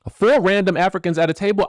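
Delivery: high twangy Southern quote. Retold or imitated. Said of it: "give you the [name] experience".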